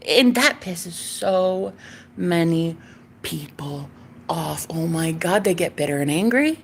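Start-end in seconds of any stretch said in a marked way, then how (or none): none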